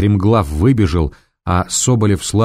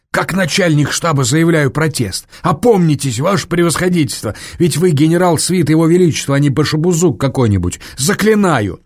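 desperate plea; 8 semitones higher than usual. A narrator's reading. Chief of staff protests desperately.

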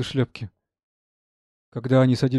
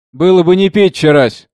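usual speaking voice

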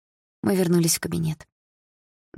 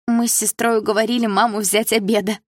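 happy, higher-pitched outburst; joyfully blurted out; 5 semitones higher, 3 dB louder than usual. A narrator's reading. Friend announces they are taking the cat, joyful blurt. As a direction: blurt out the good news with delight. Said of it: "радостно выпалила подруга".

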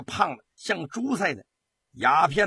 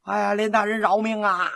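high, sustained wail for mercy; terrified wailing; 5 semitones higher, 4 dB louder than usual. A narrator's reading. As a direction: howl out your plea in terror. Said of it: "这些人吓得哭嚎起来".

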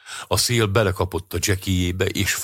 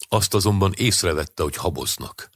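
ordinary delivery

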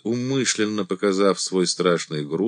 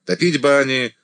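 loud, higher commanding voice; 6 semitones higher, 5 dB louder than usual. A narrator's reading. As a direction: address the whole regiment firmly. Commander addressing regiment.

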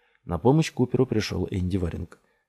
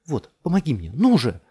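raised, higher voice, impatient; urgent urging; 5 semitones higher, 6 dB louder than usual. A narrator's reading. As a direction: spur him on loudly. Urging him with the rope. (нетерпеливо, громко подгоняет)

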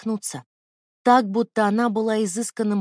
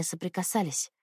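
usual speaking voice

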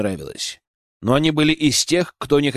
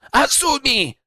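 much higher shrill squeal; squealed shout; 12 semitones higher, slower than usual. A narrator's reading. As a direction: squeal her name. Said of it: "взвизгнул Акира".

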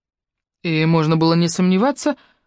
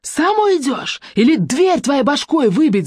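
yelling; 10 semitones higher, slower than usual. A narrator's reading. Irka yells threats at the visitors. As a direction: bellow the threat. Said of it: "заорала она".

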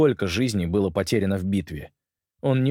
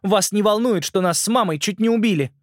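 put-on upset girl; 12 semitones higher, 4 dB louder than usual. Upset girl voiced in story.

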